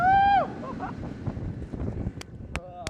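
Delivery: breathless thrilled laugh; excited laughter. Real, not acted. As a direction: laugh it off after the ride. Laughing after ride.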